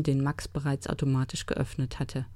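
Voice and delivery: plain reading voice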